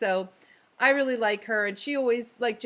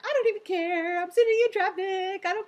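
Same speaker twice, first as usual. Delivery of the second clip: giddy retold glee; 10 semitones higher, 3 dB louder than usual. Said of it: "And I was like".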